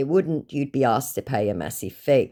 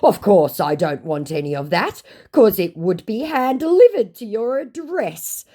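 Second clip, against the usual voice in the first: high, loud scammer assurance; exaggerated put-on scammer pitch; mock scammer; 9 semitones higher, 6 dB louder than usual. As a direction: climb higher as the persuasive scammer. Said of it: "he goes".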